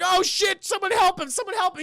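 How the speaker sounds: high, panicked cry for help; hammed-up frantic onlooker; mock panic. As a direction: shout for help like an alarmed crowd.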